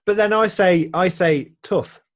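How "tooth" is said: "tooth" with a short vowel; Redditch pronunciation. Shown as a demonstration. Admitting own local way, tooth with short oo. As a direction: say "tooth" with the oo of put. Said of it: "Tooth instead of tooth".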